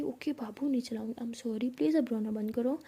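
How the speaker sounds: soft, half-whispered apology; soothing promise. Acted. Pihu promises her crying girlfriend no shouting, soothing promise. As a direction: hush her tenderly.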